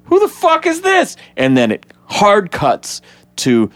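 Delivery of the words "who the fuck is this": very high squeaky outburst; shocked Barry. Retold or imitated. Barry seeing the stranger arrive, high shocked squeak. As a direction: shriek it out in confusion. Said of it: "and he goes".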